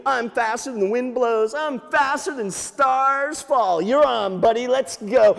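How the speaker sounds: high, punchy bragging; boastful rabbit. Acted. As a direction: brag high, stretching the big words. Cocky rabbit accepts the challenge.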